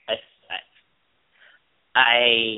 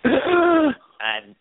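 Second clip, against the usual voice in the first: shocked gasp; 6 dB louder than usual. Real, not acted. Shocked at not watching.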